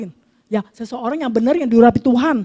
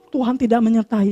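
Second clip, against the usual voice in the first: usual speaking voice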